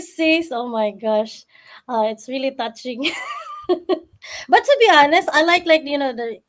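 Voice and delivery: ordinary talk, nothing marked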